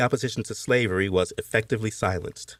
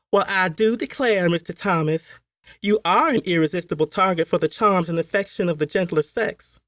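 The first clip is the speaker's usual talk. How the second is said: high, fluttery belle voice, much higher; flirting Southern belle; 10 semitones higher than usual. Acted. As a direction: coo it like a flirting society lady. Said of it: "they had often said".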